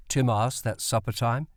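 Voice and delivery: plain reading voice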